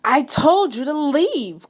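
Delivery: a fed-up bear, drawn out; cried out. A narrator's reading. Bear orders mouse out, upset outcry. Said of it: "cried Bear".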